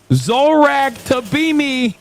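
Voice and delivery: high, slow mocking drawl on the name; mock name mangling.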